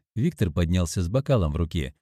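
plain reading voice

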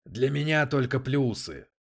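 casual, drawn-out dismissal; dismissive. A narrator's reading. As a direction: wave the objection away. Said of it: "отмахнулся Дэвид".

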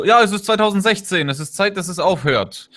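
put-on, high-pitched insistence; mock indignation. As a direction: mimic a naive campaigner's indignant plea. Mimics the campaign's simplistic demand.

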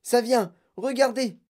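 high, thrilled exclamations; high-pitched eager alert; excited cries. A narrator's reading. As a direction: cry out with mounting anticipation. Wonka keeps crying out excitedly.

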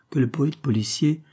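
usual speaking voice

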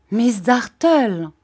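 supplication forte, plus aiguë et traînante; suppliant. A narrator's reading. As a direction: implorer en étirant les mots. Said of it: "dis-je d'un ton suppliant". (ton suppliant)

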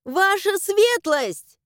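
high, drawn-out holler; loud summons to someone hidden; yelled call. A narrator's reading. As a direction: shout it through the house. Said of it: "начала орать благим матом".

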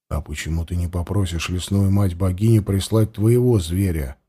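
low, quiet, doubtful; a hushed sceptical question; low questioning warrior. A narrator's reading. Varlyga asks back. (тихий недоверчивый вопрос)

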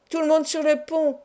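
rally the crew up on deck; crew called on deck. A narrator's reading. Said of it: "cria une voix en français". (appel pressant et aigu)

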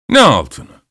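ordinary delivery, nothing marked

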